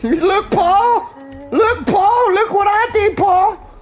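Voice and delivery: very high, squealed show-off voice; hammed-up high kiddie voice; excited little one. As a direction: call out proudly like an excited child. Voices the proud little car calling out.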